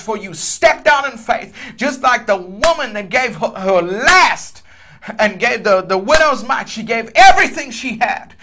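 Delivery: impassioned preacher praying over a giver; fervent prayer. Real, not acted. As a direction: pray with full conviction, surging on key words. Prays aloud for a giver.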